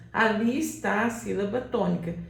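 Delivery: usual speaking voice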